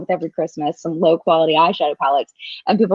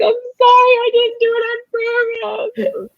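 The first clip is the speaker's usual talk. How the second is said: whiny apology; slower than usual. Retold or imitated. Her tearful apology, retold.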